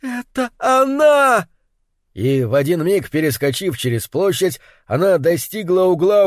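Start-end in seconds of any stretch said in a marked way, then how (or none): 0.0-1.5 high, slow, piercing shriek; piercing cry. A narrator's reading. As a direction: cry out in sudden recognition. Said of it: "пронзительный крик вырвался".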